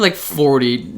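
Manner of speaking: ordinary talk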